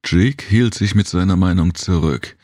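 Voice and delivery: ordinary delivery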